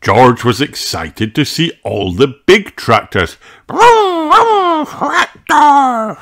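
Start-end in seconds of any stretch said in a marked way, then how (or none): 3.7-6.2 put-on very high little-boy engine noises; tiny piglet yelling vroom, much higher; toddler shouting vroom. A narrator's reading. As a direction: shout it like a toddler playing cars. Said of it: "he shouted".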